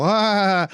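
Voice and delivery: high, drawn-out mock party holler; imitated fake-drinking cry. Pretending to drink at parties, mock holler.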